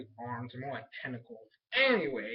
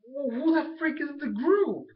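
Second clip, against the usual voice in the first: drawn-out exasperated demand, pitched higher and louder; 9 semitones higher, 5 dB louder than usual. Retold or imitated. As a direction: throw up your hands at the show. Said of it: "And I'm like".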